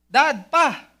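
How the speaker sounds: loud, high call from outside; acted holler to get a father's attention. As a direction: shout for Dad as if across a street. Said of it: "may tumawag sa inyo dyan sa labas".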